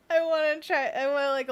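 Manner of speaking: ordinary talk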